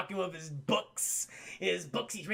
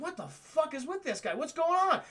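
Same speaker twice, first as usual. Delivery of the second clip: mock bewildered; 8 semitones higher than usual. Spider-Man confused voiced.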